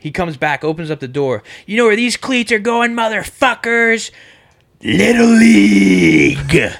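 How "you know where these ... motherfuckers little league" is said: raised voice into a long, loud bellow; taunt, then roar the last words slowly; hammed-up parting shot with a huge stretched finish; imitated irate customer. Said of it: "how I'm saying it is how he said it".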